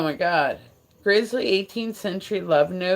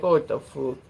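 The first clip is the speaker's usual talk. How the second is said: mock Irish disbelief; playful Irish-accented reaction; imitated Irish accent. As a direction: exclaim it as a baffled Irish viewer. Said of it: "people that were from Ireland come and watch".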